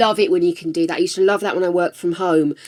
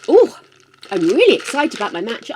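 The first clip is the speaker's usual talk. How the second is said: usual speaking voice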